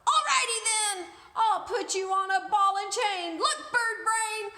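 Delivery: high, loud screechy parrot threat; screaming parrot. Acted. The parrot screams a threat at the frightened prince. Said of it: "the parrot screeched on".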